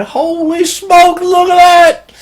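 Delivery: high, loud panicked yell; panicked scream. Retold or imitated. Russell shining a light at the eyes, screaming in panic. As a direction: scream it as if the eyes just appeared. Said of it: "he's screaming".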